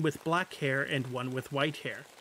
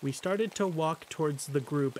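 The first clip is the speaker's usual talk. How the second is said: ordinary delivery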